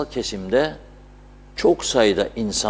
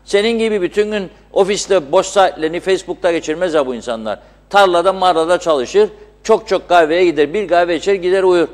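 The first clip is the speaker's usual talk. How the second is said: angry rant; 7 semitones higher, 4 dB louder than usual. Real, not acted. Host continues heated rant.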